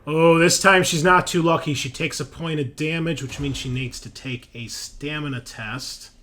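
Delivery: ordinary talk, nothing marked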